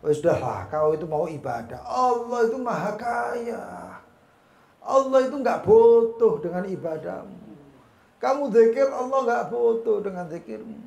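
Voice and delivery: loud, stretched-out taunting; devil tempting. Retold or imitated. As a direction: play the devil dismissing someone's worship. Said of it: "Setan, ya, bisikinya gampang".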